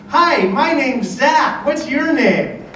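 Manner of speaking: put-on high, slow little-kid voice; Zach. Little kid introducing himself to player, high slow kid impression. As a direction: imitate an eager small boy, drawn out. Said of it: "this little kid".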